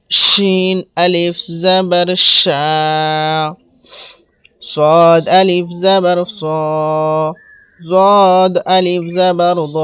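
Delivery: measured letter drill with closing vowels like সা, ফা held long; one-alif elongation. Shown as a demonstration. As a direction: draw out the syllable after each জাবার, level tone. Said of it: "এক আলিফ পরিমাণ টান দিয়ে পড়িতে হবে".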